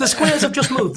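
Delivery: laughing through the punchline. Real, not acted. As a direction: chuckle out the joke. Punchline after a shared laugh.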